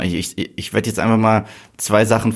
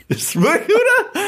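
laughing. Real, not acted. Laughing after trailer demo.

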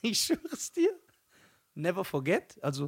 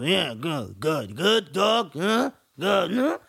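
slurred, swooping mumble; 3 semitones lower, much slower than usual. Retold or imitated. Comic shows how the rapper talked to his crowd, mock mumble. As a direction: mumble it low, rising into indistinct singsong. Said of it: "der hat nur genuschelt".

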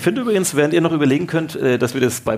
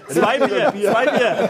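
excited cheer; 11 semitones higher, much slower than usual. Real, not acted. Cheering a correct quiz answer.

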